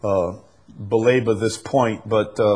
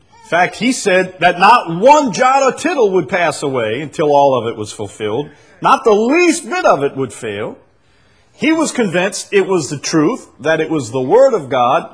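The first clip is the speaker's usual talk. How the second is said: raised, emphatic sermon delivery; forceful preaching; 6 semitones higher, 5 dB louder than usual. Real, not acted. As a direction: drive the point home with a loud voice.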